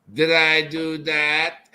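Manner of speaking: slow, nerdy Urkel whine; lightly voiced nerdy catchphrase; Steve Urkel. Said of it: "Steve Urkel".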